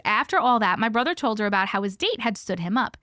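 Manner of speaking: ordinary delivery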